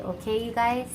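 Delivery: ordinary delivery, nothing marked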